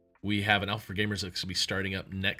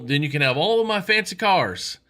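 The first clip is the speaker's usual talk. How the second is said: much higher, louder amazed Texan voice; excited rich Texan; 6 semitones higher, 7 dB louder than usual. Retold or imitated. Rich Texan congratulates the swimmer, high and amazed. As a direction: gush like a stunned rich host.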